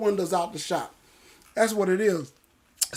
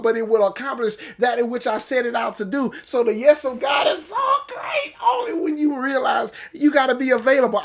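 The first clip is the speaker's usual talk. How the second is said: preaching shout; 2 semitones higher than usual. Real, not acted. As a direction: let the scripture carry you into a shout. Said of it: "I had to get that one out of my system".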